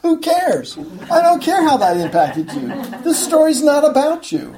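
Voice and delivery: high, punchy imitation of a preacher's accent; loud, humorous accent impression; Alistair Begg's accent. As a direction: brush it off in a put-on preacher's accent. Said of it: "he says in his characteristic accent".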